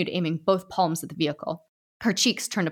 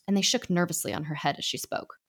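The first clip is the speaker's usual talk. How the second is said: plain reading voice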